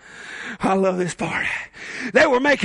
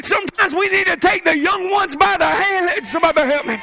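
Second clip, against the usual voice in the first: preaching at full force; 5 semitones higher than usual. Acted. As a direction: call the young ones up with fire.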